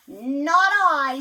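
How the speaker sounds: very loud duck voice; duck refusing. Acted. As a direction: snap out the no. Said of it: "said the duck".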